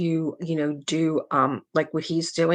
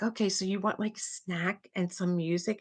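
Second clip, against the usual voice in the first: soft imitation of a dad; lightly voiced father, checking gently; father to upset child; 2 semitones higher, 9 dB quieter than usual. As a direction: play a patient dad, softly. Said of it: "her father goes".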